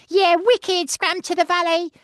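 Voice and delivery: put-on very high, loud excited British kid; zippy cartoon bike yelling wicked; Scrambler voice. Actor showing the UK Scrambler line, very high and excited. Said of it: "Scrambler was like".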